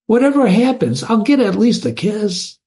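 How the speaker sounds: rising, eager longing; eager hope. Acted. Absalom dreaming of Allison's kiss, eager. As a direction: let the hope lift your voice. Lover's hopeful boast.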